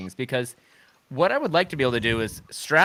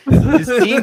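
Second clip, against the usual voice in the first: excited; 11 semitones higher, 9 dB louder than usual. Retold or imitated. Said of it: "I was like".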